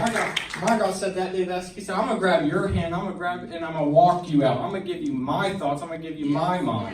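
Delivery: loud, climactic preacher's voice; full preaching. Acted. To the call-and-response crowd, shouting God's promise. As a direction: proclaim it, full force.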